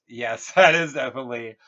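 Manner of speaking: laughing fool. Acted. Fool laughs while answering king.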